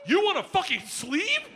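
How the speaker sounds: very high, outraged disbelief; incredulous dad. Acted. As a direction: squawk the question high in disbelief. Dad incredulous at daughter's sleeve tattoo.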